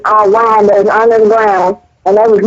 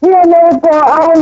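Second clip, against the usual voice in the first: mock pleading; 7 semitones higher than usual. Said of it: "they were telling y'all".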